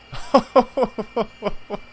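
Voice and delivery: high burst of laughter; startled laugh. Real, not acted. Player laughing at a relative's death, high shocked laugh.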